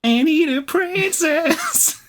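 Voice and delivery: high, slow dainty princess. Pretend. Echoes in princess voice.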